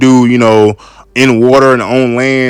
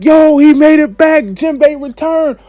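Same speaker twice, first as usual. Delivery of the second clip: hyped crowd. Retold or imitated. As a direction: shout with joy as if your friend just came back. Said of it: "everybody just hype".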